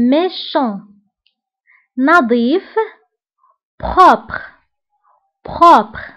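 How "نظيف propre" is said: ordinary talk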